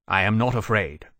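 understated anger, firmly stated; a touch of offended pride; angry retort. A narrator's reading. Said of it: "Wolvey returned angrily".

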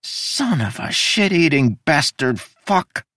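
high, slow whiny curse; whining curse. Acted. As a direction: whine it like a sulking child. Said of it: "Udo whined".

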